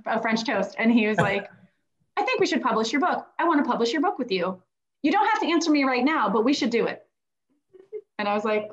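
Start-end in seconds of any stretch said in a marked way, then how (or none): 2.2-7.0 retold eager pitch, higher and quick; imitating Brian's keen rush to publish; excited offer. Brian proposing to publish at breakfast.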